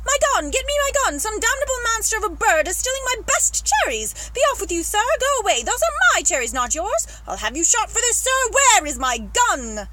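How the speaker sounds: high, loud, outraged tirade; furious shouting. Acted. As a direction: bellow at a thief stealing your cherries. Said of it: "the Duke was shouting".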